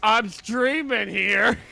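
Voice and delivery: loud, high, drawn-out yell to another room; acted shout back. Streamer shouting back through from other room, hammed-up yell. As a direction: holler it like an interrupted streamer. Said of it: "when you shout back".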